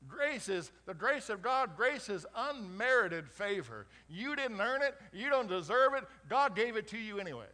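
loud, high, emphatic preaching; preaching at full force. Acted. Pastor preaching grace to the congregation, loud and fervent. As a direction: hammer grace home to the crowd.

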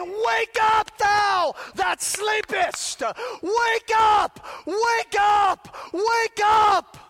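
loud, high, forceful cry of wake up; aggressive shout. Acted. Paul calling sleeping believers, aggressive shouting. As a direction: hammer it like Paul rousing sleepers. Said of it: "It's aggressive".

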